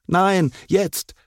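high, insistent objection; protesting. A narrator's reading. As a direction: object like an impatient schoolboy. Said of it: "protestierte Enzo".

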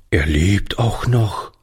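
plain reading voice

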